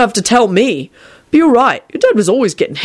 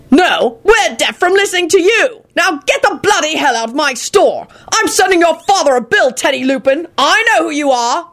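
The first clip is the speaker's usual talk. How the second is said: higher, lightly voiced furious shopkeeper; angry wizard shopkeeper; 10 semitones higher than usual. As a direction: throw them out, mildly fuming.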